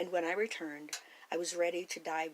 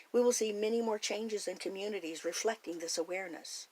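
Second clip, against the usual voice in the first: usual speaking voice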